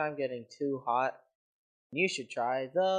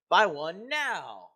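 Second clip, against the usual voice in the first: loud ad shout; 4 semitones higher, 6 dB louder, much slower than usual. Acted. Ad closing call to buy.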